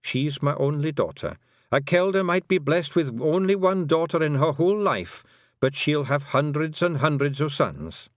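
lightly voiced old Kelda, a hint of Scots; old Scottish Kelda. A narrator's reading. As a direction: speak as the old pixie mother, proud and homely.

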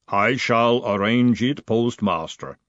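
slow, heavy clay-giant voice; golem rumble. Acted.

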